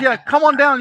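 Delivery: lightly acted, upbeat invite; retold eager invitation. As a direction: voice her invitation with a lift. Retold friendly invitation to visit.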